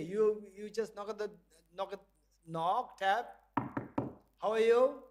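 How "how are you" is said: called out loud into the box. Shown as a demonstration. Teacher calling into the box, loud. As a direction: call out to whoever is inside.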